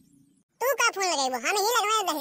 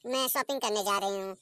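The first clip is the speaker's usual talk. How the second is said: ordinary delivery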